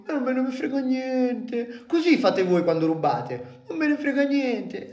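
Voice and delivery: high mocking parody voice; mocking rival fan. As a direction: mimic them whining. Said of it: "Com'è che fate voi?".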